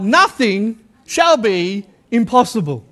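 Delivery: usual speaking voice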